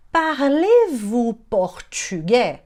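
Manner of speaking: slow, drawn-out syllables; slow teaching demo. Shown as a demonstration. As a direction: sound it out slowly for learners. Teacher models phrase slowly. (demonstração lenta e pausada)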